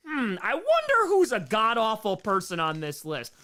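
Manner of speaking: loud, high mock musing; hammed-up speculation; mock pondering. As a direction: wonder aloud with exaggerated curiosity. Mock quote of idle speculating.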